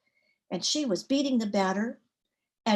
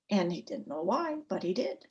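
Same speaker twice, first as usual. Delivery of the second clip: ordinary delivery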